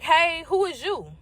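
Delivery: slow, high-pitched confrontational question; blunt challenge. Retold or imitated. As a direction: challenge the stranger at the door. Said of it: "I said just like that".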